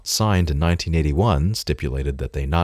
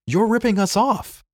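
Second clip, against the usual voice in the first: much higher, shouted accusation; shouting. Acted. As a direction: hurl the accusation at him. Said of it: "Steve shouted".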